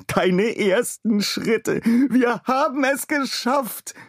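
proud inventor cheering first steps; begeistert rufend. A narrator's reading. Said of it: "rief er begeistert". (Adam ruft begeistert über die ersten Schritte des Roboters)

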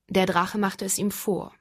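ordinary delivery, nothing marked

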